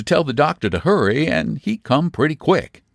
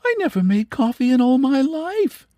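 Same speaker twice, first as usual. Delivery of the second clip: astonished; 12 semitones higher than usual. A narrator's reading. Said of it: "dreadfully astonished".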